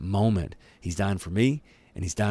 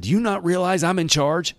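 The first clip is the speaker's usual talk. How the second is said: indignant Pilate; 10 semitones higher, 3 dB louder than usual. Retold or imitated. Preacher voices Pilate's indignation.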